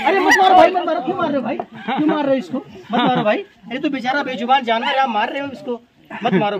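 real alarmed plea, jumping high and loud at first; urgent plea. Real, not acted. Snake rescuer begging a man to stop hitting it, urgent plea. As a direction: plead urgently, starting high and easing down.

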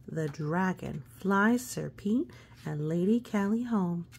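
ordinary delivery

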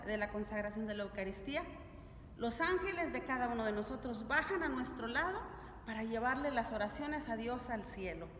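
ordinary delivery

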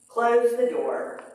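ordinary delivery

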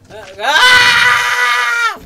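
high piercing shriek; frightened scream. Real, not acted. Gamer shrieks as a zombie attacks. Jump scare.

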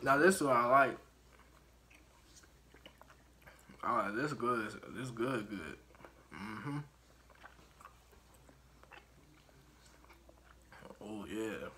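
talking through sticky candy; chewing while talking. Real, not acted. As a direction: mouth full of taffy. Seen 2: Puts taffy in mouth at -7; jaw and cheeks keep chewing throughout.

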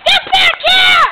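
loud, high chasing yell; loud shout. Pretend. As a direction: yell after the runaway. Role-play chase shouting.

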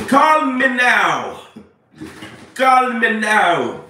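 drawn-out TV psychic catchphrase; Miss Cleo. Acted. Streamer mocks a psychic hotline ad for followers, drawn-out impression. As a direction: stretch it out like a hotline commercial pitch. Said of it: "y'all talking to Miss Cleo".

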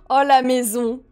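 ordinary talk

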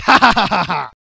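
hammed-up triumphant cackle; villain laugh. Gloating over the flattened aliens, hammed-up cackle. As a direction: laugh over the crushed foes, hammy. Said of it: "And I'll be like".

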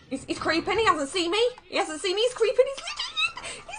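rising, breathless excitement breaking into a laugh; high, tense and amused as he sneaks past; excited giggling. Real, not acted. Tense moment, enemy sneaking close.

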